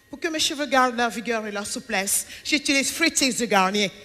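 a hint of hushed TV-ad seduction; shampoo-ad model. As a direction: lightly imitate a model selling shampoo. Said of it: "elle se pointe devant la caméra".

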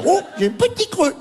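high, hammed-up craving line; mock ad actor. Mocking how no one really talks, high and hammy. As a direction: overplay a sudden little hunger.

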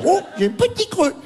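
high, hammed-up dreamy craving; mock Joey craving a snack. Mocking the ad's fake hunger, high and hammed-up.

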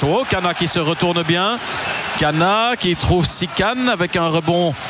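ordinary talk

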